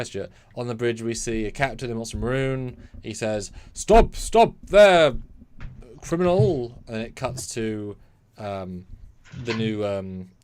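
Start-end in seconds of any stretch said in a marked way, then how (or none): 3.7-6.8 loud, high, drawn-out captain's challenge; hammed-up stop-right-there shout; commanding shout. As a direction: shout it slowly, like a captain halting a criminal.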